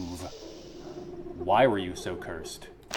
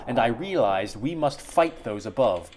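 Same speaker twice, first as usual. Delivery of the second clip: ordinary talk, nothing marked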